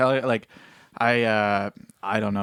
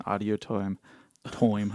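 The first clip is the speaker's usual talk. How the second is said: soft, lower attempt at Scottish; fake Scottish accent; 4 semitones lower, 6 dB quieter, slower than usual. Shown as a demonstration. Host pitching a fake accent to his co-host, fake Scottish. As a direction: try out a bad Scottish accent quietly. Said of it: "pitch my fake Scottish accent".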